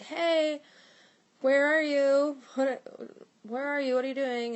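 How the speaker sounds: calling out loud and high to a friend downstairs; yelling down the stairs. Retold or imitated. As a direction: shout up high, searching for someone. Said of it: "I yelled down the stairs".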